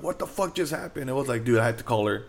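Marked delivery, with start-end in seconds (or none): 0.0-1.1 high-pitched, quiet mock scream; softened yelp of shock, retold. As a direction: imitate waking up screaming, without the volume. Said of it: "I was just screaming when I woke up".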